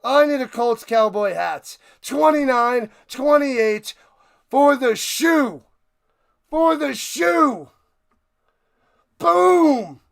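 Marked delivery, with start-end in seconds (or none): 2.0-7.7 slow, loud, drawn-out victory call; triumphant score call. Real, not acted. Calls out the winning score. 9.2-10.0 one long, high, booming exclamation; explosive shout. Real, not acted. Celebrating the win.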